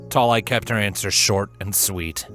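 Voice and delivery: ordinary delivery, nothing marked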